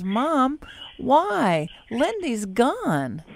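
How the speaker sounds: put-on high, drawn-out child's question; retold high child voice; little daughter. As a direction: imitate a small girl stating the obvious to Mom. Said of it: "And she goes".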